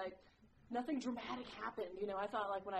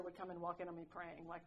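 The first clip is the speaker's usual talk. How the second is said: normal talking voice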